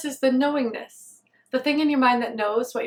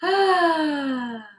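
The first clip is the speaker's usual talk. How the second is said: heavy sigh; 4 semitones higher than usual. Real, not acted. Meditator in pain pauses before naming it, heavy sigh. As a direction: let out a big audible breath. Pausing while talking of pain.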